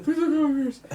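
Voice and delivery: high, laughing imitation of begging; mock pleading. The buddy begging the guard, lightly mocked. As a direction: repeat the plea like a panicked kid. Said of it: "that's all he kept saying over and over".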